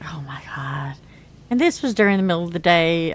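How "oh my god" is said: whispered, much softer and lower; dismayed whisper. Retold or imitated. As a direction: breathe it out in quiet disbelief. Dismay at daughter, retold.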